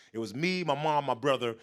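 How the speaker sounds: ordinary delivery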